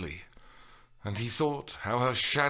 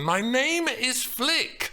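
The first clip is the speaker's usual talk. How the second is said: high, slow, emphatic correction; slow, high, fed-up yell; exasperated shout; 13 semitones higher, 4 dB louder, slower than usual. A narrator's reading. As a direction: spell it out in exasperation. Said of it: "she shouted in exasperation".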